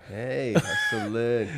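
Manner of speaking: slow, drawn-out cheer of hustling; cheering call. Real, not acted. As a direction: cheer him on, stretching it out. Cheering guest's teen job.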